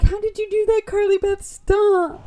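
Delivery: put-on high-pitched panicky boys; hammed-up alarmed protest; mock freaked-out. Boys freaked out by talking head.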